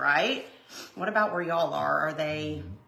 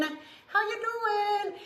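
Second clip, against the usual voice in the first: "How you doing?" higher, slow, stretching out "doing"; sing-song greeting; 12 semitones higher, 3 dB quieter, much slower than usual. Shown as a demonstration. Greeting a viewer in chat, high slow sing-song.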